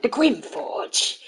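slow, drawn-out GRIM FORGE; dramatic line read. Shown as a demonstration. Streamer recalling a recording session, slow dramatic read. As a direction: stretch "Grim Forge" out theatrically.

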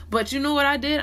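normal talking voice

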